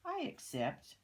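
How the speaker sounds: a spider happily accepting a ride; joyful spider. A narrator's reading. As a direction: squeak it out with delight. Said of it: "with joy and delight".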